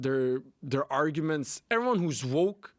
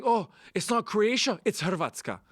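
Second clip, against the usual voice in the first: mock indignant Croatian; 7 semitones higher than usual. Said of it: "a Croatian get mad at".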